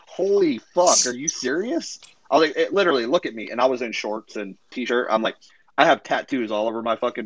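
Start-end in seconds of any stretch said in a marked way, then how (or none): 0.1-2.0 floored by what he just heard; stunned "are you serious"; incredulous. Retold or imitated. Retold reaction to accusation.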